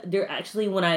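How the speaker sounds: usual speaking voice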